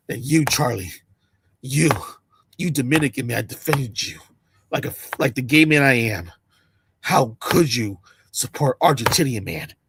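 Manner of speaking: mock heartbroken drunk fan; low drunk voice. Voicing the drunk fan at Charlie.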